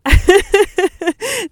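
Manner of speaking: bright high-pitched laugh at herself. Real, not acted. Laughing at own jumpiness.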